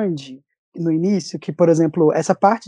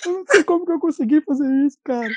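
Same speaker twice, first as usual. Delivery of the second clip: high-pitched tearful blubbering; mock sobbing, words rushing out; imitated crying; 11 semitones higher, much faster than usual. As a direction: wail it like someone overwhelmed. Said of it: "eu tô chorando".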